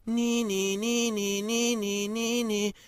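high, even wailing "nee nee"; police siren. Acted. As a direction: imitate an approaching siren. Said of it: "the police will be here".

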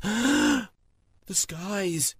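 high, astonished exclamation; gasping surprise. Acted. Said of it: "gasped with surprise".

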